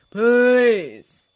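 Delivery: loud scornful please; sneered. A narrator's reading. Holmes scoffing at Snape, loud sneer. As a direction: dismiss him with a sneer. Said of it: "Holmes sneered".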